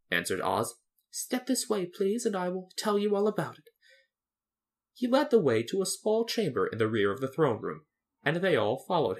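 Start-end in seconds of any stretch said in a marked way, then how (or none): 1.1-3.7 high-pitched little man; eager-to-explain little man; obliging Oz. Acted. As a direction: usher them along, pitched up. Little man Oz invites them.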